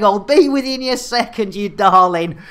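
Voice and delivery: usual speaking voice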